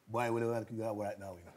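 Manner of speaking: put-on low, quiet Caribbean accent; Caribbean barber. Recalling a barber's hard-to-follow question, imitated Caribbean accent. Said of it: "he was Caribbean".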